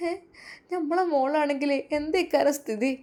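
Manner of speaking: soft, pleading mother's voice; pitiful plea. Acted. Mother pleads softly with her son to stay. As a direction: ask him softly, as if begging. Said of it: "ഉമ്മ ദയനീയമായി നോക്കി".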